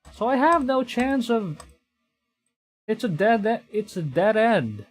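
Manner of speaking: high, loud, fed-up yell; loud, high-pitched frustration at a dead end; exasperated outburst. Real, not acted. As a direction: blurt it out, furious that he knew this would happen. The beasts swarm in again.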